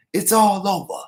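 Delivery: loud, high resignation; traitor giving up. Retold or imitated. Traitor announcing it's all over, loud and high. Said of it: "He's like".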